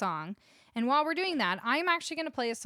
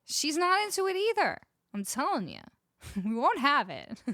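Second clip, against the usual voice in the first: ordinary delivery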